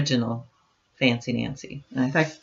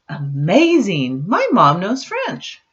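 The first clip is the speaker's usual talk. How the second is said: slow, louder wide-eyed wonder; amazed; 4 semitones higher, 4 dB louder, slower than usual. Acted. Nancy amazed her mom speaks French, slow louder wonder. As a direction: marvel at it like a little girl.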